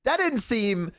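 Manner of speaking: high, slow, uneasy disapproval; squeamish hesitation. Retold or imitated. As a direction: act out wincing and searching for polite words. Acting out a squeamish reaction.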